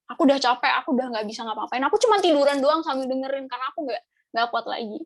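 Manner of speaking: normal talking voice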